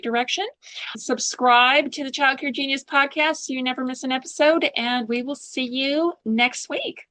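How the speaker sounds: host's bright closing plug to listeners; podcast outro. Acted. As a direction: sell the sign-off to listeners.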